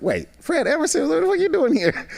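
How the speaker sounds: spotting a famous actor unexpectedly; startled double take. Retold or imitated. Retold double take on seeing star.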